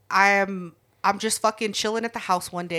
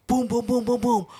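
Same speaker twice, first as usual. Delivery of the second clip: usual speaking voice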